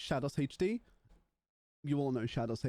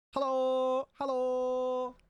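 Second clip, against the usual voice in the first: sing-song greeting; 9 semitones higher, 4 dB louder, much slower than usual. Acted. Greeting stream viewers in sing-song.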